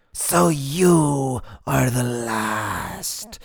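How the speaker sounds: high, loud, drawn-out howl; monstrous wailing proclamation; howling creature. A narrator's reading. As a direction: howl it slowly, stretching every word. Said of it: "it howls into his face".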